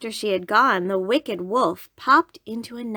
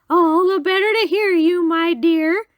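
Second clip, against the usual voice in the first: high, loud, cheery put-on granny voice; wolf playing a cheerful grandmother; 9 semitones higher, 8 dB louder than usual. A narrator's reading. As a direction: pitch it way up, falsely cheerful. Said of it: "said the wolf in a cheerful voice".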